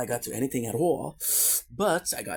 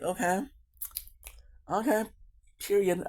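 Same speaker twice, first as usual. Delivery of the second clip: sassy approval; 9 semitones higher than usual. Retold or imitated. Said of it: "I'm watching Royal Court and I'm like".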